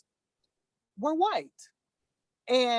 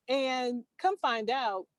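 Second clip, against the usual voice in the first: ordinary delivery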